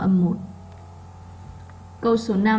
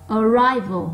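RI in "arrival" punched loud; stressed second syllable; 3 dB louder, slower than usual. Shown as a demonstration. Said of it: "nó to hơn những âm còn lại". (nhấn mạnh âm hai của "arrival")

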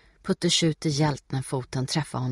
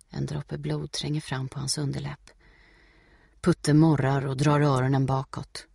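ordinary delivery

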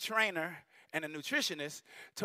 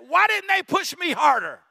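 put-on grown child's lament; 7 semitones higher, 10 dB louder than usual. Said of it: "He said".

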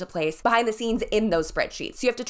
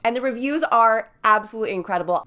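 ordinary talk

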